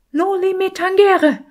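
high, loud bawling skull; crâne qui braille. A narrator's reading. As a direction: blare it out far above your own voice, loudly. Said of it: "plärrte der Schädel".

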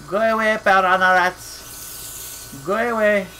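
hammed-up French accent, slow on piranha; slow, silly French-accented shooing; goofy French accent. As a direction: shoo the rats in a mock French accent. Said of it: "my goofy French accent".

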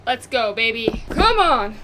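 ordinary delivery

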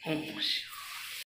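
slow, whispered mantra chant; a hushed prayer inside the cave; quiet mantra. Real, not acted. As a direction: breathe the mantra softly, devotionally.

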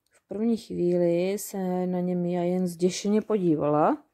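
plain reading voice

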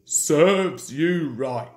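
hooted poops, then a slow gloating jibe; toot, then drag out the taunt; Gordon's engine taunt. A narrator's reading. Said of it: "Gordon would say".

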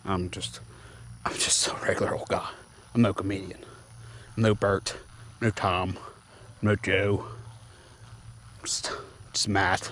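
soft, trailing self-mockery; quiet self-deprecating aside, half unvoiced; self-deprecating mumble. Real, not acted. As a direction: mutter it down, almost to yourself.

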